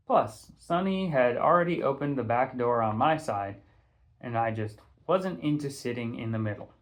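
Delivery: ordinary delivery, nothing marked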